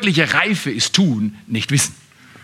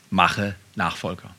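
gedämpft, langsam, tief; leise und tief; 11 semitones lower, 7 dB quieter, slower than usual. Real, not acted. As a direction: sag es ernst und leise. (leise, tiefe Betonung)

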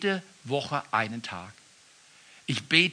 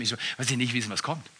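usual speaking voice

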